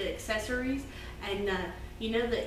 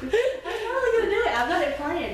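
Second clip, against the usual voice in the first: high, loud laughing voice; 8 semitones higher, 7 dB louder than usual. Real, not acted. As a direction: say it through a laugh.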